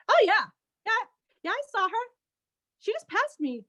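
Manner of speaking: lightly voiced farmer, pitched high; a hint of an old farmer recalling.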